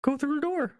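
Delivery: high, squeaky little mouse. Acted. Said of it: "The mouse is not smart".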